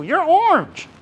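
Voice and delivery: triumphant group ribbing; gleeful teasing. Retold or imitated. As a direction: rub it in with glee. Group repeats verdict, gleeful.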